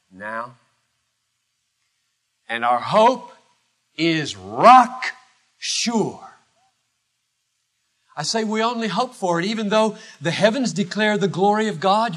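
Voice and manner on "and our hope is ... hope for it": ordinary delivery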